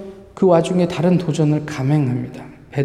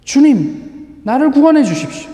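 higher, louder sustained plea for rescue; desperate cry; 9 semitones higher, 6 dB louder than usual. Acted. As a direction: shout for rescue in panic. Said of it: "계속 소리 지릅니다". (높고 크게 절박하게 외침)

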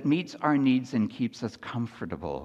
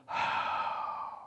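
a long, soft, breathy sigh of relief; 6 dB quieter than usual. Retold or imitated. Disciples sigh as Jesus gets in the boat, relieved sigh. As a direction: let all the worry out in one sigh. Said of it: "they sigh".

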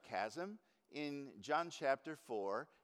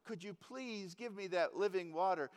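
eager, higher-pitched plea; excited request; 4 semitones higher than usual. Acted. Woman begging Jesus for living water, excitedly. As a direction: ask as if all excited. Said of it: "I'm all excited about that living water".

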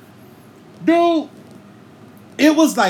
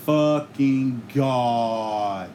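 slow despair; 7 semitones lower, 3 dB quieter, much slower than usual. Retold or imitated. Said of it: "I was like".

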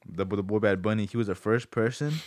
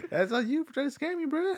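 high teasing voice. Real, not acted.

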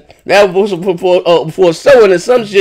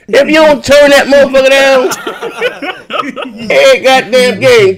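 laughing mock; 7 semitones higher, much slower than usual. Mocking echoing sound bar.

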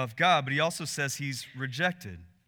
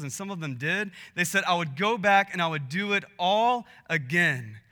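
higher and louder, driving each line home; preaching at full force; 5 semitones higher, 4 dB louder than usual. Acted. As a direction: build the sermon to a passionate peak. Preaching climax about the team.

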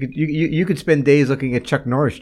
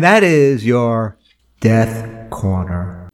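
announcer voice; 4 semitones lower, much slower than usual. Pretend. Segment title announcement.

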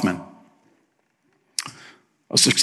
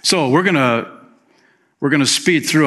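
ordinary delivery

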